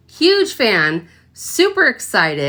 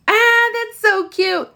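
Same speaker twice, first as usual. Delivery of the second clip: delighted gush; 12 semitones higher than usual. Real, not acted. Admiring the dish.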